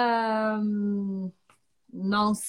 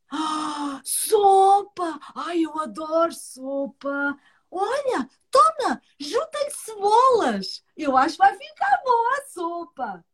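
fox voice; 4 semitones higher, slower than usual. Acted.

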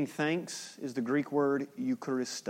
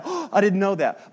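higher, louder mock astonishment; mock surprise; 6 semitones higher, 8 dB louder than usual. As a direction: play up a fake gasp of surprise. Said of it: "So he's not like".

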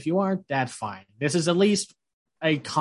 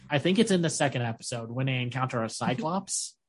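ordinary talk, nothing marked

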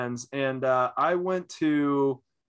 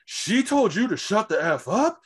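higher and louder, an offended imitated woman; indignant mock outrage; 7 semitones higher, 4 dB louder than usual. Stranger's girlfriend turning around to pick a fight, in high indignant outrage. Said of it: "she's just like".

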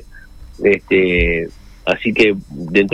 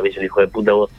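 ordinary talk, nothing marked

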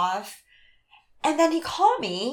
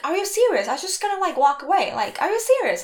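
imitating the date, higher and louder in exasperation; mock angry date; 5 semitones higher, 5 dB louder, faster than usual. Retelling her date's outburst in the park, mock indignant. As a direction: throw it back like an offended man. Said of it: "Like, he's pissed".

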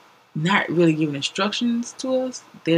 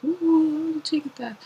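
sarcastic cheer; 12 semitones higher, much slower than usual. Retold or imitated.

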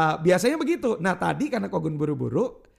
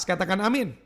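ordinary talk, nothing marked

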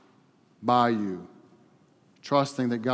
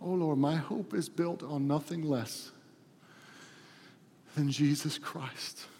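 soft, halting prayer with long pauses; hushed, heartfelt prayer; closing prayer; 9 dB quieter than usual. Real, not acted. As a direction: pray quietly and reverently to God.

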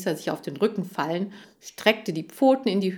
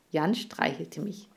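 plain reading voice

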